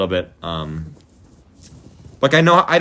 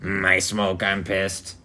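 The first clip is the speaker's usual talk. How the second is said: South Park guy; 5 semitones lower, 7 dB quieter, much slower than usual. Retold or imitated.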